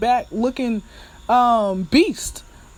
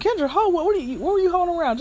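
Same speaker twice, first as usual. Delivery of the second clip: high-pitched voice of puzzled listeners; mock a nosy audience, pitched way up; questioning listeners; 9 semitones higher, much faster than usual. Listeners question the host's love life, high mock disbelief. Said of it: "I know y'all think".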